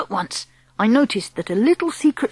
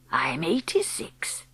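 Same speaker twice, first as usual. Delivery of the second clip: put-on slow, soft, whispery old woman; hushed elderly granny voice; old grandmother; 5 semitones lower, 9 dB quieter, slower than usual. Grandmother answers the boy.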